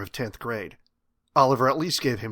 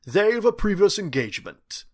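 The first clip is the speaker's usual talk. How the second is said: high, loud, polished British voice; suave British accent; 8 semitones higher, 5 dB louder than usual. A narrator's reading. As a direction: counter her smoothly in British English. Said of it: "never losing one ounce of his suave".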